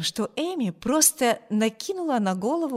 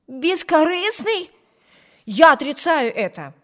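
objecting; 7 semitones higher, 6 dB louder than usual. A narrator's reading. Brother rejects his sister's praise of Bruce, objecting. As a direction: throw the word back in disbelief. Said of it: "возразил Виберт".

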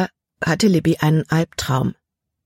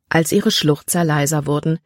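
plain reading voice

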